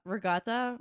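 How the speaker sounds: "regatta" with the final "ah" sounded; full ending. Shown as a demonstration. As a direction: say all syllables of "regatta". Full form before Jersey version.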